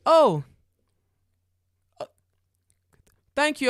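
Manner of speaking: high, loud startled thanks; mock surprised fireman. Fireman surprised he did everything, high startled thanks. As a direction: sound caught off guard.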